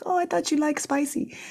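lightly voiced friend, high and teasing; a playful hint of the cook friend, high; imitating Parul. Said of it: "She's like".

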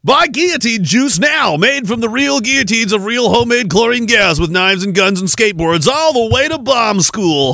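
slow, high mock commercial hard sell; hammed-up ad pitch. Streamer hawking guillotine juice to viewers, hammed-up ad pitch.

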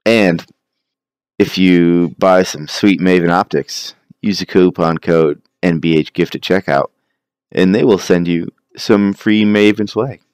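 loud upbeat podcast promo read. Acted. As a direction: read it like a sponsor plug.

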